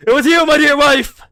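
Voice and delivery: high, loud theatrical cry; hammed-up loud revelation; dramatic accusation. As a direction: proclaim it like a great unmasking. Acted accusation in game dialogue.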